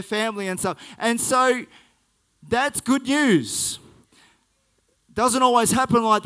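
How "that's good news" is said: usual speaking voice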